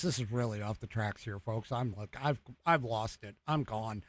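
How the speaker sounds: low, soft aside, trailing away; defeated aside. Real, not acted. Host admits to folks he's off the tracks, low defeated aside. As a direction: sink down low and quiet, giving up.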